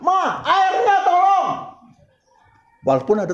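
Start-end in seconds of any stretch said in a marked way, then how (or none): 0.0-1.5 high, slow put-on husband voice asking for water; drawn-out high mock plea to the wife; hammed-up husband asking his wife for water; mock husband's request. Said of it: "Nggak perlu sampai diucapkan".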